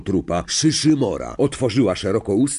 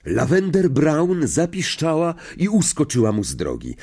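plain reading voice

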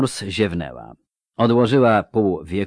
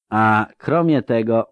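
usual speaking voice

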